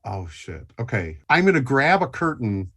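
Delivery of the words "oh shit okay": much softer, lower gasp; hushed dread. Acted. Player seeing the snake creature, hushed dread. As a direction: breathe it in dread. Recognizing the monster.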